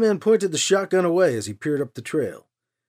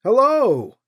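higher, louder hello called down a trail; calling out; 7 semitones higher, 7 dB louder than usual. A narrator's reading. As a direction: call it across the distance. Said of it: "Alibi called back".

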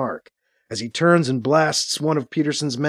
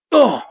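short, raised grunt of a man shot; grunt when hit; 5 semitones higher, 4 dB louder than usual. A narrator's reading. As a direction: grunt as if struck by a blast. Narrator voices henchman being hit.

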